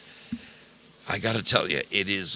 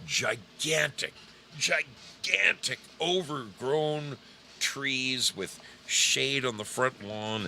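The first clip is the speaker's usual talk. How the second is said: awed; 6 semitones higher, slower than usual. Real, not acted. Awed description of huge trees.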